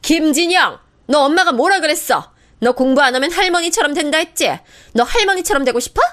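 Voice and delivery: sister-in-law yelling at her son; shouting mother. Acted. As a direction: scream it at the child in contempt. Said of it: "소리치는 거예요".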